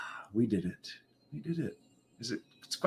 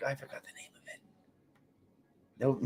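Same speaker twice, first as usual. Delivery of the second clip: normal talking voice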